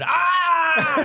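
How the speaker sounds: high, loud anguished cry; mock anguished wail. Contestant losing his head at the table, loud high wail. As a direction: let out a long despairing wail. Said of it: "five minutes of him going".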